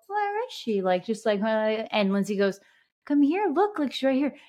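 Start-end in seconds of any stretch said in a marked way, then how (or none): 0.1-0.8 put-on much higher, little-girl voice, lightly done; toddler voice. Niece looks for her aunt on FaceTime, high toddler voice. 3.1-4.4 higher, louder sing-song coaxing; coaxing the toddler. Retold or imitated. Sister shows toddler the aunt on screen, high coaxing.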